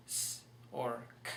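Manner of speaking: S as a hiss, K as a bare click; letter sounds. Shown as a demonstration. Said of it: "C can be pronounced".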